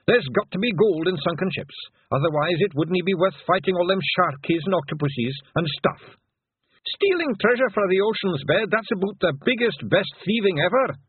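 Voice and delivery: high, eager Pictsy voice; Rob Anybody, excited Feegle. Acted. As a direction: crow about treasure like a little Feegle.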